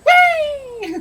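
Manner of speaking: put-on very high, gleeful squeal of a young dragon; young dragon shouted off a cliff, squealing with glee; gleeful dragon whee.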